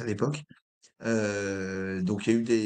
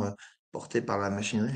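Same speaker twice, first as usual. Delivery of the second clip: usual speaking voice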